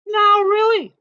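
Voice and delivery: high prim mother bear; Mama Bear scolding. A narrator's reading. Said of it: "said Mama Bear".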